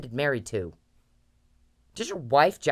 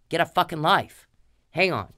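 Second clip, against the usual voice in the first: ordinary delivery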